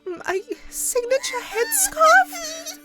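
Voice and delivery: slow, high, aghast question; appalled disbelief. Acted. Rarity asked to give up her scarf, high aghast disbelief.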